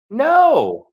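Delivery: squeaky high disbelieving really; a high put-on gasp of surprise; mock surprised high voice. The forgetful guy asks in disbelief, squeaky high.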